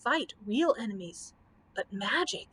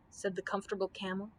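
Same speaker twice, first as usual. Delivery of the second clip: ordinary delivery, nothing marked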